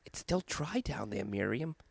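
creepy hushed prank call; hushed caller. Retold or imitated.

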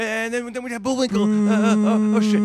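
very loud, high frantic rush; panicked shouting. Retold or imitated. Booed comic panics through his cartoon lineup, shouting. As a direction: scramble through the bit in panic.

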